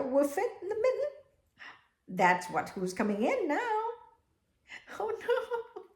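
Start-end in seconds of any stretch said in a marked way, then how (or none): 5.0-5.8 high, laughing oh no; mock alarm. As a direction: play up the fright for the kids.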